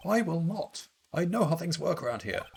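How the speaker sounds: high-pitched, huffy insistence; defiant refusal. Acted. Refusing to calm down.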